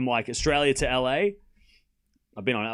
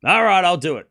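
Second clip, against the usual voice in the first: mock reluctant giving in; 4 semitones higher, 7 dB louder than usual. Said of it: "You just had to ask".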